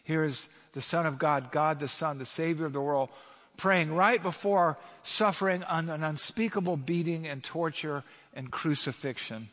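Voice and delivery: normal talking voice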